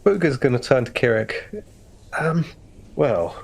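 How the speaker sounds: usual speaking voice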